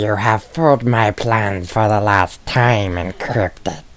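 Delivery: slow, drawn-out villain threat; mock villain. Friend playing the villain to Encrypted, slow mock villain voice. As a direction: intone it as a cartoon bad guy. Villain line performed back in jest.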